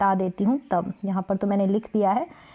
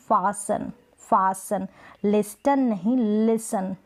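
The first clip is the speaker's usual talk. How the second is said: ordinary delivery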